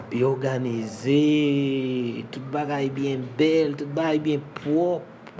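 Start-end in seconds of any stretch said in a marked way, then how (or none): none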